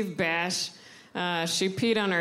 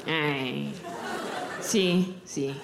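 put-on lower, slow old abuela cooing in Spanish; great-grandmother admiring her granddaughter, lower and fond; great grandma's voice; 6 semitones lower, 3 dB quieter, much slower than usual. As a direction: croon like a doting old grandmother. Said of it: "my great grandma's voice".

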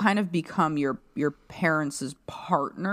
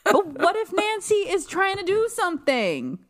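much higher, exasperated hello; incredulous high voice; 12 semitones higher, 3 dB louder than usual. Acted. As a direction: squeak out an outraged hello. Mock-defending Nancy.

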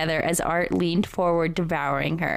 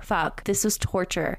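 ordinary delivery, nothing marked

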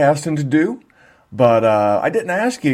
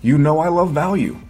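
upbeat promo voice-over read; 4 semitones lower than usual. Acted. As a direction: sell the Patreon like a radio spot. Podcast ad read over music.

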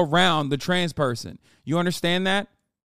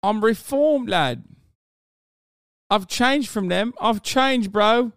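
mock London lad, loud and high; hammed-up British bloke, yelling; rough london slang; 5 semitones higher, 5 dB louder than usual. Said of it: "Typical fucking British idiot".

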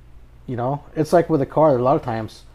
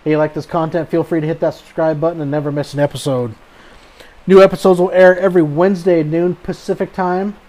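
podcast outro; 2 semitones higher, 3 dB louder than usual. Acted. Host reads podcast outro.

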